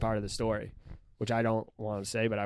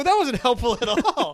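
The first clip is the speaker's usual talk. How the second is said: mock annoyed; 12 semitones higher, 8 dB louder than usual. Scolding mom in retold story.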